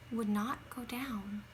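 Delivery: soft, unvoiced, near tears; choked whisper. A narrator's reading. Child in bed fighting tears, barely whispered. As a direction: let the lump in the throat stop the voice. Throat tightening, whisper heard.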